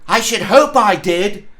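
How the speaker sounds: a cocky answer; boy's reply. Acted. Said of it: "replied the lad".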